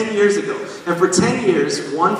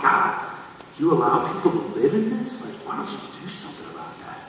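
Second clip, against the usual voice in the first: angry plea to God; 2 semitones higher, 5 dB quieter, faster than usual. Retold or imitated. Angry prayer retold.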